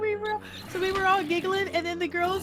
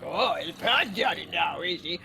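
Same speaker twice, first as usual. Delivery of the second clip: much lower, mock-scandalized mother voice; a hammed-up exasperated mom; imitating mom; 13 semitones lower than usual. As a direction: play the disapproving mom, dropping way down. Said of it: "she'd be like".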